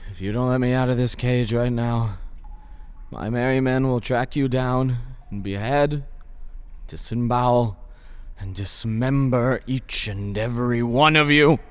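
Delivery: low, measured menace; menacing threat. Acted. Prisoner threatening his guards with revenge, low menacing tone.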